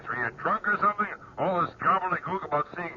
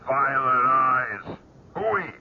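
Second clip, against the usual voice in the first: scornful scoff; 6 semitones higher, much slower than usual. Acted. Boss scoffs at the article.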